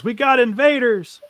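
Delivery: a lookout yelling a warning to the others; alarmed shout. Acted. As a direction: raise the alarm. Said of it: "one of them hears and says".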